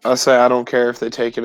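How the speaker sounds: usual speaking voice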